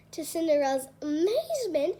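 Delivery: ordinary delivery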